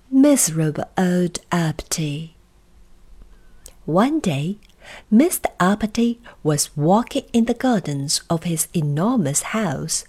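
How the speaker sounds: plain reading voice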